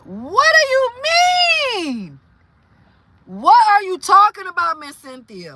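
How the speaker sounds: high, loud outburst of disbelief; shocked disbelief. Real, not acted. Reacting to a viewer's amputated nail story, shocked disbelief. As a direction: demand an explanation, stunned and loud.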